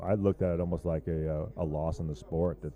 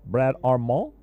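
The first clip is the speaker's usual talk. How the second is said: mock French name calling; 5 semitones higher, 7 dB louder, much slower than usual. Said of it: "we're gonna just do French accents for everybody".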